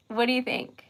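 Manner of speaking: ordinary delivery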